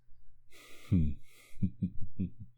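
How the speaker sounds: gloating villain laugh. Acted. Vampire gloats over captive.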